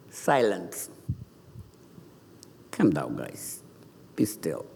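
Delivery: low, hushed order; quiet command. Acted. Jesus stills wind and waves, low hushed command. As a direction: settle them down softly, with calm authority. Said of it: "said to the waves".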